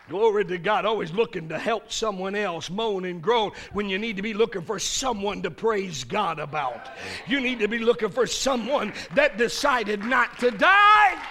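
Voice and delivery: rising, loud preacher's cry; full-force preaching. Acted. Preacher exhorting the congregation, building to a shout.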